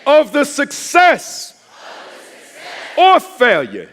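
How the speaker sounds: full-force high preacher's shout; thunderous, drawn-out chant lead; roof-raising shout. Real, not acted. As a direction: scream each phrase slowly for the crowd to echo. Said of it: "take this roof right off of this".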